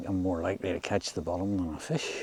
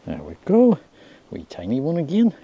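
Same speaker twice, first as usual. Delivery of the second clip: excited; 7 semitones higher, 8 dB louder than usual. Real, not acted. Landing a fish, delighted.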